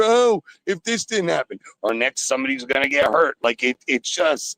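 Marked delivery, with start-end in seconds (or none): 0.0-1.6 mocking regret. Caller mimicking regretful fans, high whiny mock. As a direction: whine it like a sore fan.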